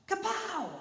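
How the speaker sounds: kapow sound effect. Acted.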